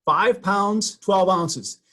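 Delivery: showing the correct weight with force; emphatic reading. Shown as a demonstration. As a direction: hammer the numbers home. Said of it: "You see that?".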